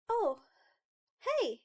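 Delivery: put-on high creeper-girl greeting; high-pitched voice. Creeper girl greeting the newcomer, very high-pitched. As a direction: pipe up in a very high voice. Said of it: "very high-pitched voice".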